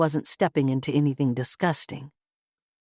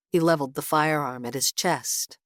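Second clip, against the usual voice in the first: plain reading voice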